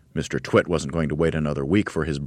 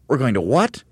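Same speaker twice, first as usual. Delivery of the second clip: higher, louder disbelief; astonished cry; 8 semitones higher, 4 dB louder than usual. Acted. Little monkeys crying out to their dad, astonished. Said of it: "they cried".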